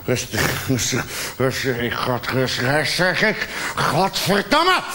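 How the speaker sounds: calm breaking down. Acted. Jealous man losing his self-control, mutter rising to a shout.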